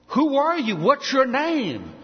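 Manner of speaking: awestruck Moses. Acted. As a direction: ask in trembling wonder. Moses asks God's name.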